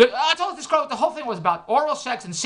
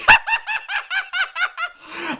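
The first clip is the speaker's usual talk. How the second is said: big high cackle. Real, not acted. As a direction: cackle at your own punchline. Laughs at own joke.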